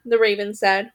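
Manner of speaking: usual speaking voice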